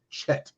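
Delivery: "Chet" with an English ch, no throat sound; mispronounced Chet. Shown as a demonstration. Mimicking people who mispronounce Hebrew, English-style "Chet". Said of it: "they pronounce it as".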